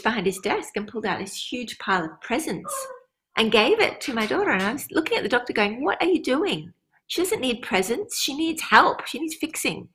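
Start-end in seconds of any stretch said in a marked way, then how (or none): 5.8-9.9 higher-pitched, disbelieving objection; retold indignant protest. Mother objecting to the doctor about her daughter, indignant protest. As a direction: protest in a raised pitch, as if correcting someone.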